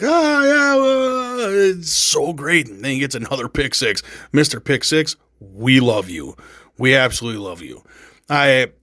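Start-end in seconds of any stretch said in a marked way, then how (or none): 0.0-2.7 high, airy mock player voice; lightly voiced interview answer, drawn out at the end; imitating a player. Fan mimicking the player's happy London interview, high and drawn out. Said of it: "he's like".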